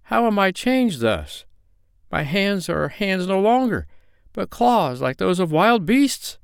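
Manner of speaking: high, dismayed little voice; dismayed little sprite. A narrator's reading. The cursed sprite cries to his pine tree, high and dismayed. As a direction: cry out to the tree in small distress. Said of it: "cried the Little Brown Man in dismay".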